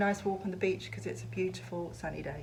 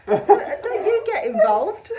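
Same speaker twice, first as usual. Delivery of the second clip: laughing; 10 semitones higher, 14 dB louder, much slower than usual. Real, not acted. Laughing at partner's teasing.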